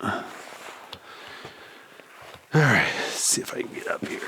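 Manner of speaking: breathy whisper while climbing. Real, not acted. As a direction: whisper it to yourself.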